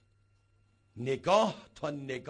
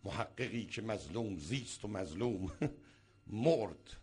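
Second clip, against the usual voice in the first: mocking a self-pitying scholar; 6 semitones lower than usual. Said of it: "همش میگه".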